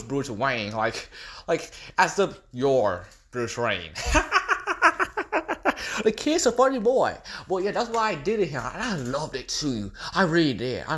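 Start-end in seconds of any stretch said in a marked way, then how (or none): 4.1-7.2 high, cackling glee; cackle, then laugh the words; maniacal laugh. Acted. Joker laughing at Batman over Robin.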